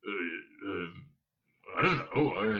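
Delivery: low, soft, halting mumble; dim, hesitant guard. A narrator's reading. Guard asked why he does it, hesitant. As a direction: grope for words, stumped.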